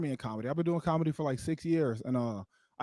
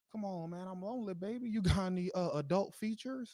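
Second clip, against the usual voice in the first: sly pleading; 4 semitones higher, 8 dB quieter than usual. Retold or imitated. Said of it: "He's like".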